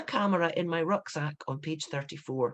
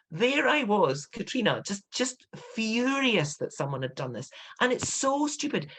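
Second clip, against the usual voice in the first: exasperated; 6 semitones higher than usual. Retold or imitated. Reliving fury at bad clue.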